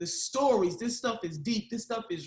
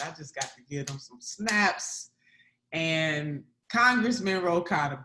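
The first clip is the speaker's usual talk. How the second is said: normal talking voice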